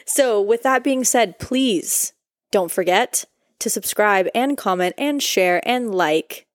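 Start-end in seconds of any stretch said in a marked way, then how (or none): 1.4-6.5 drawn-out, slow, syllable by syllable; slow outro plea. Real, not acted. Hosts asking listeners to subscribe, very slow. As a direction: stretch every word of the plea. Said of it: "drawn-out outro plea".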